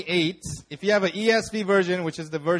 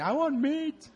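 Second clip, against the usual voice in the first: high, light whining craving; mock whine; 9 semitones higher, 6 dB quieter, slower than usual. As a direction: beg for meat like a hungry kid. Fasting wife's mock craving.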